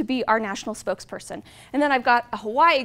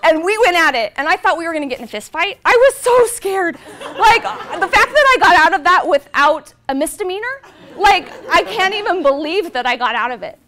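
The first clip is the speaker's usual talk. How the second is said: worked up; 4 semitones higher, 11 dB louder than usual. Real, not acted. Retelling the fight, still worked up.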